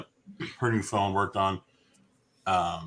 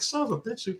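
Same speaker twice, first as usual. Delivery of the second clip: fed-up aside; 13 semitones higher, 5 dB quieter than usual. Retold or imitated. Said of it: "I'm like".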